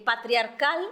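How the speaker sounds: normal talking voice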